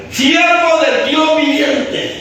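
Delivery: high, slow, drawn-out mournful call; calling out sorrowfully. Acted. King calls to Daniel in the lions' den, crying out mournfully. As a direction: call down into the pit, stretching the words. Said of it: "llamó a voces a Daniel con voz triste".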